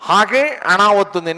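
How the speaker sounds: ordinary talk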